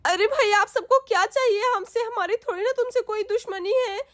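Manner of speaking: high, tearful mother pleading with the robbers; a mother crying out in fear at gunmen; frightened mother. Acted. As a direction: voice a scared mother close to tears. Mother faces armed dacoits.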